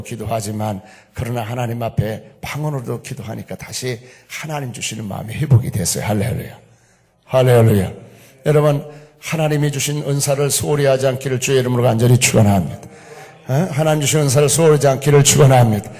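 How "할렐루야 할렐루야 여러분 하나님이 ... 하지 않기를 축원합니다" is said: loud, forceful blessing; full-force preaching. Real, not acted. Preacher blesses congregation to keep God's gifts, loud and fervent. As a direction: proclaim it to the whole church, driving each phrase.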